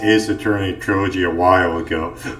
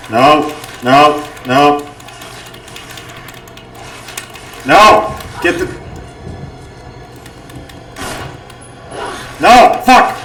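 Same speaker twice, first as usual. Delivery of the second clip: frustrated yelling; 10 semitones higher than usual. Real, not acted. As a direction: yell in real frustration. Repeated deaths, yelling in frustration.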